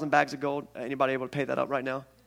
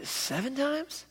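hopeful question; 9 semitones higher, 7 dB quieter, much slower than usual. Retold or imitated. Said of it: "Peter says".

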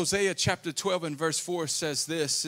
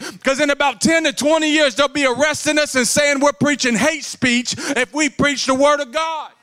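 loud, high, driving preacher's cry; full-force preaching; 8 semitones higher, 8 dB louder than usual. Acted. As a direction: preach it hard to the whole church.

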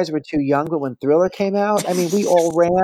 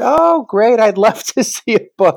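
higher and louder, overeager with a laugh; fake enthusiasm; 7 semitones higher, 6 dB louder than usual. Thanking Janet for the book, loud fake delight. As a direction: gush to hide the panic.

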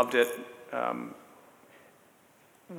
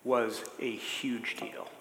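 slow, hushed emphasis; whispering; 8 dB quieter, much slower than usual. Real, not acted. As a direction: lean in and whisper it. Emphatic aside about the movie.

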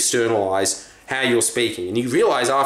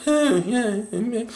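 bored audience member; 8 semitones higher, much slower than usual. Retold or imitated. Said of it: "sitting there being like".

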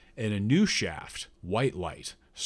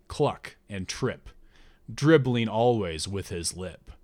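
slow, solemn oracle voice; voice of a god; slower than usual. Acted. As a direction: intone it like a god through the priestess. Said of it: "in the voice of a god".

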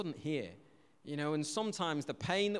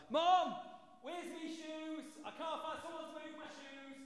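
high, drawn-out distant call; mock a child calling from far across the house; imitated kid shouting; 11 semitones higher, 7 dB quieter, slower than usual. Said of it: "there's that shouting down".

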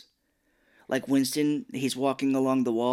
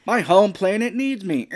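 loud, higher mock dramatic exit; Simpsons quote; 8 semitones higher, 9 dB louder than usual. Quoting The Simpsons as a joke exit, hammed-up loud. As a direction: declare it like a cartoon hero leaving. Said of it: "Like The Simpsons".

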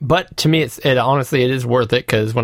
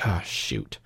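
dismayed groan; 9 semitones lower, 13 dB quieter than usual. Retold or imitated. Retold dismay at long game.

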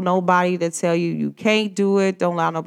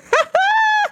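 shrieky, very high burst of laughter; high-pitched laugh; 3 dB louder than usual. Acted. Speaker imagining things flowing for you, high delighted laugh. Laughs after acted amazed reaction.

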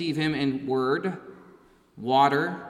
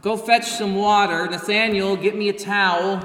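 louder, raised-pitch commands to servants; bossy master giving orders; 4 semitones higher, 6 dB louder than usual. Pretend. Preacher voicing a demanding master who orders the disciples around, mock bossy. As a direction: bark out chores like a lord at the head of the table. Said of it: "He doesn't say".

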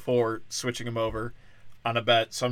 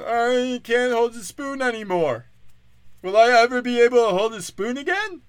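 mock concussed player; 10 semitones higher, 5 dB louder than usual. Comic mocking a hurt quarterback, slurred high voice. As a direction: whimper it out like a dazed player.

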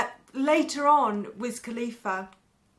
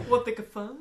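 laughing; 3 semitones higher, 4 dB louder than usual. Real, not acted. Repeating a joke, laughing.

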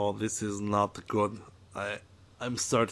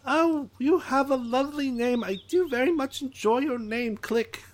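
excited alien Trixel; 12 semitones higher than usual. Acted. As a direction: greet him like an overeager little alien. Drawl compliments Click.

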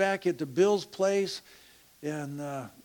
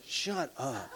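mock annoyed, laughing; 3 semitones lower, 6 dB quieter than usual. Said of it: "they go".

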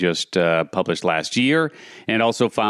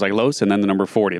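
ordinary delivery

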